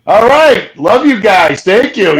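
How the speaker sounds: loud, high, hearty send-off; shouted farewell. Retold or imitated. Investigator calling goodbye to friends driving off, shouted farewell. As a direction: call it out to departing friends.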